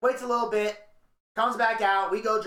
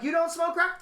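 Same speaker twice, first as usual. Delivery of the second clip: casual mocking question; 9 semitones higher than usual. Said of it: "She's like".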